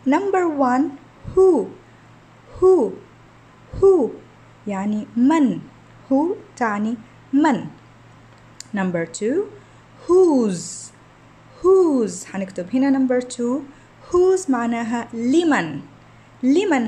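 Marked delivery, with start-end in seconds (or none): 1.3-4.1 "who" said loud and high, clearly repeated; pronunciation drill. Shown as a demonstration. Teacher drilling "who" for pupils, loud and high. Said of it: "أنا حلفظ الكلمة وإنتوا عليكم ترقموا". 10.1-12.2 "whose" louder, with a clear z ending; pronunciation drill. Shown as a demonstration. Teacher modelling "whose" for pupils, louder. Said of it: "أنا حلفظ الكلمة".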